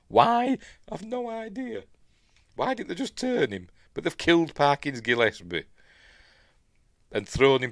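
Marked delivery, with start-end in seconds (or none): 0.1-3.7 high, baffled question; incredulous. Real, not acted. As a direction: sound truly baffled. Mock puzzlement about the killing.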